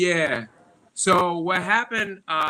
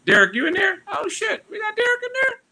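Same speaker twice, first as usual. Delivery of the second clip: excited shout, much higher; calling out; 5 dB louder than usual. Real, not acted. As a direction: call out loudly to a friend. Calling out to a friend.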